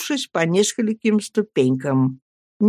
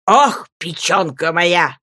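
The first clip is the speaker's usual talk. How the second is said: put-on howling old man; slower than usual. A narrator's reading. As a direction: bellow it like a crazed old man. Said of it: "орал старик".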